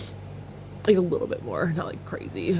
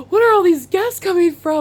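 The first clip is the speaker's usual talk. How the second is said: surprised; 10 semitones higher, 6 dB louder than usual. Retold or imitated. Retelling her surprise at the guest singers.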